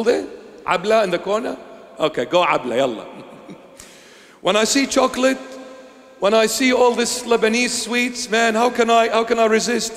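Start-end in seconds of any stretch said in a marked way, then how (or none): 4.4-9.9 high, loud, fast mock craving for sweets. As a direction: ham up the longing for sweets. Preacher mimics craving sweets.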